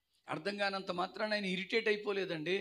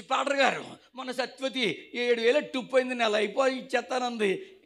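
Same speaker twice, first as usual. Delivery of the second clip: raised, pitched-up grievance to the pastor; loud complaint; 4 semitones higher, 8 dB louder than usual. Acted. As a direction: press your complaint loudly. (గట్టిగా ఫిర్యాదు చేయడం)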